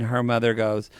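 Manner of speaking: normal talking voice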